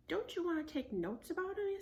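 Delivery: put-on high, coaxing little girl voice. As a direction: coax him like a kid sister.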